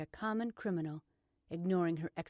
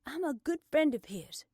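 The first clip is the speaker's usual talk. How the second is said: high, loud, proud assertion; defiant; 8 semitones higher, 6 dB louder than usual. A narrator's reading. Blair to the arresting officer, defiant. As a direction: say it with the chin up. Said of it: "throwing her chin up".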